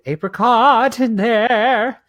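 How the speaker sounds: high, drawn-out hammed-up repeat; sing-song overdone delivery; playful exaggeration. Exaggerates it back after being mocked.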